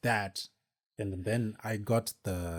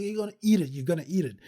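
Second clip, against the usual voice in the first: high, louder sing-song mock taunt; taunting insistence; 8 semitones higher, 4 dB louder, much faster than usual. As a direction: keep needling him, pitched up. Cousin insists again.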